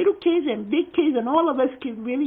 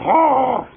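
loud imitated dog growl; big mock growl; dog growl back; 2 semitones lower, 5 dB louder than usual.

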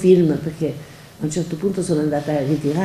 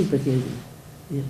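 ordinary delivery

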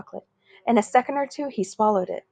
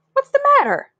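high and louder, pushy; demanding; 5 dB louder than usual. Acted. Bully Spider confronting John, high pushy demand. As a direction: demand an answer. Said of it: "Spider demanded".

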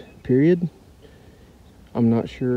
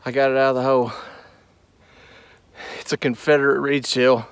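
ordinary delivery

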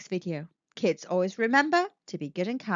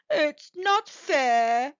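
sad; 4 semitones higher, 4 dB louder, much slower than usual. Acted. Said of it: "he thought sadly".

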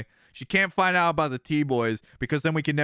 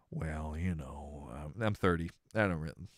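usual speaking voice

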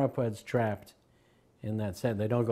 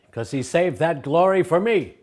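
bold retort; 6 semitones higher, 9 dB louder than usual. Retold or imitated. Prabhupada's bold retort quoted.

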